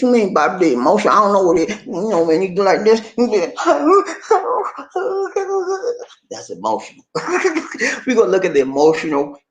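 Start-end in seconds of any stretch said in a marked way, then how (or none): 3.6-6.0 high laugh. Real, not acted. Laughs at his own joke.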